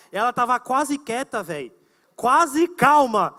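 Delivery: normal talking voice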